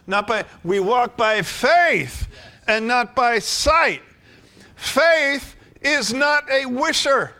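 slow, high, loud preacher's proclamation; slow, booming sermon delivery; full-force preaching. Acted. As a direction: ring it out over the congregation.

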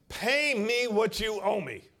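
loud, high, slow angry snarl; harsh demand. Acted. Unforgiving servant throttles his debtor, loud angry demand. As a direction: demand it with a fist on his throat.

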